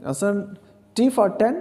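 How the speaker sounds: ordinary talk, nothing marked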